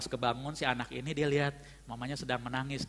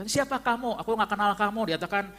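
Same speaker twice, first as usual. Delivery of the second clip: higher, imitating a cold, rude denial; harsh rejection; 6 semitones higher than usual. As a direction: push the stranger away with retold contempt. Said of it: "Dia ngomong kasar sekali".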